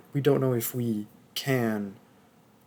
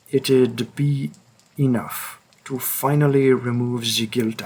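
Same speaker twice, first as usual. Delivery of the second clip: put-on French accent. Hunter forcing the listener to come along, French accent. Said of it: "tried to do a French accent".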